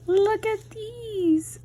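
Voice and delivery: high, slow, delighted; excited. Real, not acted. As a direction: draw it out in awe. Showing off swatches.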